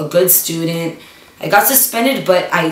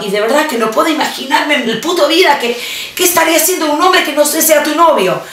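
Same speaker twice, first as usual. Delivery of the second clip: rushed excuse; 8 semitones higher, faster than usual. Retold or imitated.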